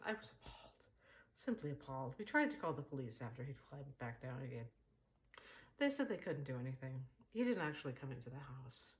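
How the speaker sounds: ordinary delivery, nothing marked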